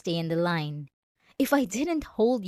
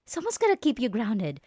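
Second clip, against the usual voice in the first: ordinary talk, nothing marked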